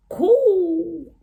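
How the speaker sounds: high, loud, stretched-out "Cool"; exaggerated cool.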